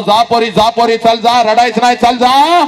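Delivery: loud, high, brave send-off; cheerful father. Acted. Father sends his daughter away, loud forced cheer. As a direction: push her off with forced cheer. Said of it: "हसत हसत तुला म्हणतो".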